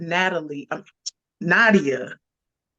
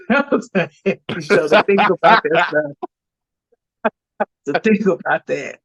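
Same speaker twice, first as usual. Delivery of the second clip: real laughter breaking up the words; laughing to keep from crying; 4 dB louder than usual. Real, not acted. Guest overcome by a heavy show, laughing to keep from crying. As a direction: laugh helplessly through a heavy remark. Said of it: "That's why I keep laughing".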